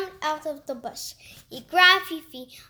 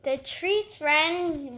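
plain reading voice

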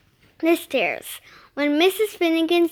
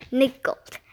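usual speaking voice

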